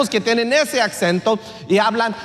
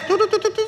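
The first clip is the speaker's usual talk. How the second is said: playful tu-tu hum; 11 semitones higher, much faster than usual. Real, not acted. Playful filler sounds.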